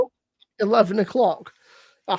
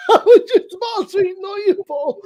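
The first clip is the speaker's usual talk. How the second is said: laughing it out, higher and louder; 7 semitones higher, 4 dB louder than usual. Retold or imitated. Admitting to Michelle he nearly ignored his friend, laughing. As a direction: crack up mid-sentence.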